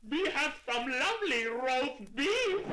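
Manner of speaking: shout. Acted. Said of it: "he shouted in a voice so loud".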